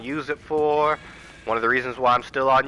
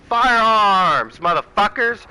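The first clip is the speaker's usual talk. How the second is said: loud, higher burst on firearms; emphatic shout; 6 semitones higher, 4 dB louder, slower than usual. Real, not acted. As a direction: punch it out like a rally cry. Punchline shout about guns.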